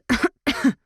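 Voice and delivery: sharp cough from a swallowed drink. A narrator's reading. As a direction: cough as if the drink went down wrong. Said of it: "I coughed".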